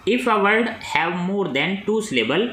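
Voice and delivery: reads the exam question very slowly, word by word; slow dictation reading. Acted. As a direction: dictate it so students can write. (प्रश्न धीरे-धीरे बोलकर लिखवाना)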